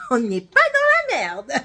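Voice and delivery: high, loud, drawn-out and laughing; laughing exclamation. Real, not acted. Vlogger joking about her muddled accents, laughing loudly. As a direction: burst out laughing as you say it.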